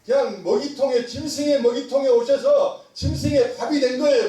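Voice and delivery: high, insistent pulpit delivery; forceful preaching. Real, not acted. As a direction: drive the point home to the congregation.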